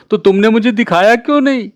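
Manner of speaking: a mildly hammed little girl complaining; whining little sister. A narrator's reading. Little sister protesting to brother who wouldn't show her, whiny child voice.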